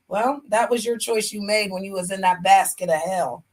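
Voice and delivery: usual speaking voice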